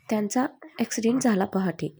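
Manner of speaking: ordinary delivery, nothing marked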